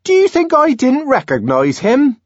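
high, loud, indignant woman; indignant Linda. A narrator's reading. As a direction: bristle at the doubt. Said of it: "Linda asked indignantly".

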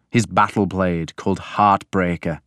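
plain reading voice

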